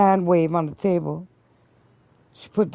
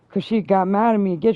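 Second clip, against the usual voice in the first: ordinary talk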